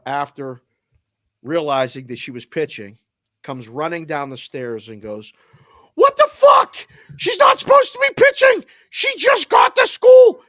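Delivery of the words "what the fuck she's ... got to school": screaming in panic, much higher and louder; panicked shout. Retold or imitated. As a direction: shriek it like a mother panicking at the news. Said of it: "She's panicking".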